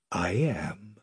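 soft breathy whisper, low. A narrator's reading. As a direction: answer in a hush. Aslan answers quietly.

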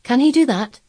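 high, loud, eager question; excited boy wanting to know. A narrator's reading. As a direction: ask with keen excitement. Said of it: "he asked eagerly".

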